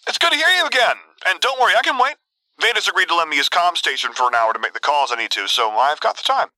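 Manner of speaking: beaming, high-pitched cheer; Luke. A narrator's reading. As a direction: start delighted and high, settle a little. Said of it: "sounding like it belonged to someone who was rightly beaming".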